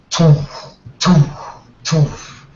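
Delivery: puffed "toof" thuds, spaced out; imitated cane thumps. Game master sounds out the prince slamming his cane, imitated thuds. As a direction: mimic a cane striking the floor.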